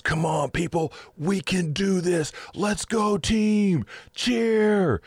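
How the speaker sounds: rally the sad crowd like a peppy cheerleader; excited rally cry. Retold or imitated. Encourager rousing a sad crowd, high excited cheer. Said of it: "they start yelling out loud, bold statements".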